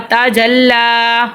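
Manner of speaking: تا جلا with the final "laa" held long; lengthened vowel. Shown as a demonstration. Teacher showing learners how to lengthen letters, drawn-out vowel. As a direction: stretch the last vowel of تا جلا. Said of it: "حرکات کو لمبا کریں گے".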